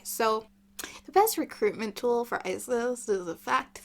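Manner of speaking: usual speaking voice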